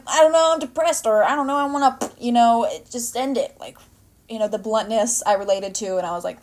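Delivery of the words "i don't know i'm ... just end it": louder, higher mock outburst; blunt retort. Answering people who ask what's wrong, blunt outburst. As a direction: throw it back at them, exasperated.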